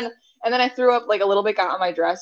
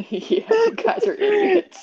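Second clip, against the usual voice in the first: high, slow, laughing through the words; laughing tease; 14 semitones higher, much slower than usual. Real, not acted. Friend teasing them about the unplugged microwave, laughing.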